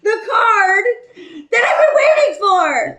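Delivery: very high, loud wail of exasperation; riled-up yell. Real, not acted. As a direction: shriek it, worked up and indignant. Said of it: "you guys are all riled up now".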